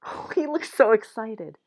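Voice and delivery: thrilled side comment to the listener; gushing aside. Real, not acted. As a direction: squeal a little at the page.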